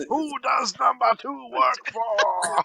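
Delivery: slow goofy put-on voice; mock Tom Arnold. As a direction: drag it out in a put-on voice.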